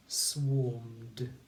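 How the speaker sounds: ordinary talk, nothing marked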